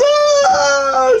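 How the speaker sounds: very high, loud mock wail; hammed-up high sob-scream; imitated crying wail. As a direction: bawl it out, imitating her crying. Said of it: "She's crying, she's crying, like, literally crying".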